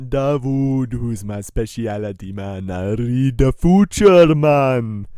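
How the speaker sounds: slow, drawn-out Jamaican; hammed-up Jamaican fortune teller; Jamaican accent. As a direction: stretch it out in a fake Jamaican accent. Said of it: "Replace your T's with D's".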